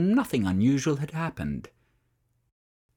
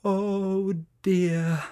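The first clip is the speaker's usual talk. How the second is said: sigh; 6 semitones higher than usual. A narrator's reading. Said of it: "sighed Francesca".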